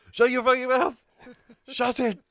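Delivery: loud, high angry shout. Real, not acted. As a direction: yell at them to stop laughing. Shouting at laughing co-hosts.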